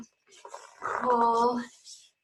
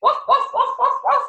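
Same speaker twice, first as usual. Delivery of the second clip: high, quick woofs; string of high-pitched barks; dog bark; 10 semitones higher than usual. Shown as a demonstration. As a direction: woof like a little dog.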